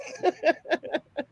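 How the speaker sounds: high burst of laughter. Real, not acted. Laughing at the story.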